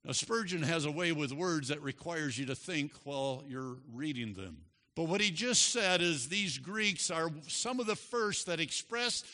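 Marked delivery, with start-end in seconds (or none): none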